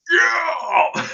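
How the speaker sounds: mock crowd roar, high and loud; a crowd's whooping yell, played for laughs; imitating crowd cheer. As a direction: cheer like a whole arena. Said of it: "And the whole crowd just went".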